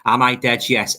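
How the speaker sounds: ordinary talk, nothing marked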